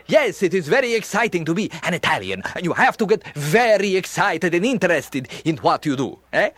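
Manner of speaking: lively stage Italian, full of excitement; animated Italian accent. Shown as a demonstration. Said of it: "Now, Italians are very, uh, animated".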